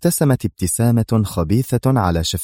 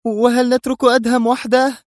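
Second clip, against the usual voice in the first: high-pitched agitated protest; distraught woman's question; tense cry; 4 dB louder than usual. A narrator's reading. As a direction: cry out anxiously, pitched far up. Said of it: "صاحت في توتر".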